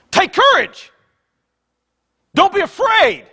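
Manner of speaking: high, forceful shouted command; emphatic shout. Shown as a demonstration. Preacher showing how Jesus really said it, emphatic shout. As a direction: shout it as an urgent order. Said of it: "It's in the emphatic case".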